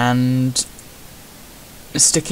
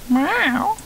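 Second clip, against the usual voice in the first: cat meow. Retold or imitated. Imitating cat again.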